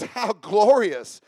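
drawn-out sarcastic praise. Acted. Wife mocking the king, mildly sarcastic. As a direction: stretch GLORIOUS slowly with mock admiration. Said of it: "This is sarcasm".

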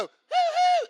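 imitating a high whooping call to friends; high hoo-hoo call. Kids calling friends out to play, high whooping hoo-hoo. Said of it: "if you heard somebody go".

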